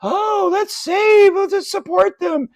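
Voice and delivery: high, loud, drawn-out mocking quote; hammed-up campus do-gooder; mock college activists. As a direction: gush naively in a put-on high voice. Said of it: "these people on college campuses are all going".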